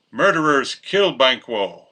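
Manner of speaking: usual speaking voice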